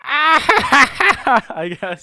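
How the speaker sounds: high cackling Joker laugh. Pretend. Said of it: "He, he sounds like this".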